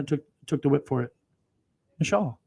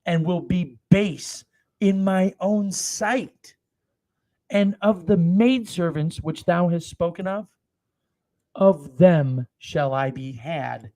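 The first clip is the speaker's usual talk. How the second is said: slow, loud, weighty Bible reading; slow thundering scripture read; solemn scripture reading; 3 semitones higher, 3 dB louder, slower than usual. Acted. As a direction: proclaim each phrase slowly like holy writ. Reads David's reply aloud.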